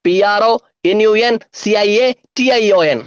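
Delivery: letters bundled into quick groups; brisker, grouped letter chant; speedy spelling. Shown as a demonstration. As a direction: rattle off the spelling in chunks. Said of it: "സ്പീഡിൽ എങ്ങനെ പറയാം".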